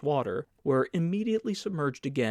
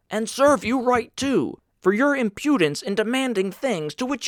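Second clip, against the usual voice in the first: loud, higher scolding official; indignant head purser; 6 semitones higher, 6 dB louder than usual. A narrator's reading. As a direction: berate him, voice climbing in outrage. Purser berating the stoker.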